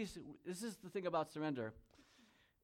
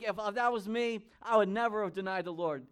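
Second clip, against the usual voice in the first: loud, higher-pitched self-assured protest; confident protest; 6 semitones higher, 7 dB louder than usual. Acted. Preacher voices listener sure they would stay loyal, loud protest. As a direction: insist on it, voice raised in mock certainty.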